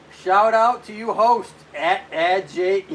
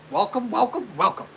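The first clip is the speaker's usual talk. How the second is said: higher-pitched mock welcome; imitating another streamer; 5 semitones higher than usual. Streamer greeting viewers as she says it, playful imitation. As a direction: copy her chirpy repeated greeting, lightly. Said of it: "as she says".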